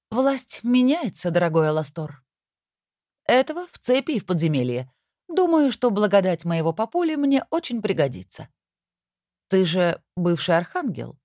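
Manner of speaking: lightly voiced triumphant young queen; a hint of a gloating queen. A narrator's reading. Antichrist queen gloating over father.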